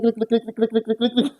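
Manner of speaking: high, quick click-click imitation; mock click sounds. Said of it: "So you guys gotta hear".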